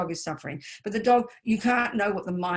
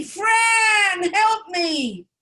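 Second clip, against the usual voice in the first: crying high shout for help, much louder and higher; screaming like a baby; 12 semitones higher, 7 dB louder, much slower than usual. Retold or imitated. Nun who fell down the steps yelling to her friend, crying shout. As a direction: wail for your friend like a hurt baby. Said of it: "I screamed like a baby".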